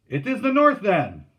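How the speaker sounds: very high, loud agreement; hearty shout. A narrator's reading. Steersman settles the camp for all, shouting. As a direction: belt it out decisively.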